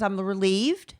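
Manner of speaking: normal talking voice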